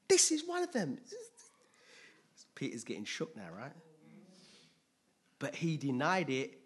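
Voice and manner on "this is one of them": high, loud mock accusation; accusing servant girl. Servant girl outing Peter to bystanders, high-pitched accusing cry. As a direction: point him out in a loud, high voice.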